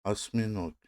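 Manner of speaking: آسمینوک said as Russian "vosminog", the o heard as a; آسمینوک as a clear teacher's model; careful Russian. Shown as a demonstration. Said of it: "دقت کنید".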